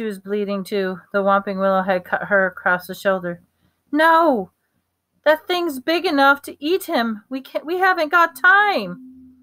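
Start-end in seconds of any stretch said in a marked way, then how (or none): 3.9-9.3 high, frantic objection; high-pitched, pressing urgency; urgent protest. Acted. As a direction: cry out in panic against waiting. Acted urgent argument over rescue.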